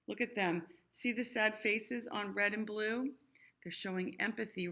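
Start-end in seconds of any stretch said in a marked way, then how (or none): none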